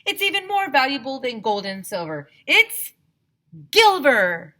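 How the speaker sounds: rising to a loud high reveal; Mister Burke revealing Gilver, loud build; building excitement. A narrator's reading. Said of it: "Mister Burke said".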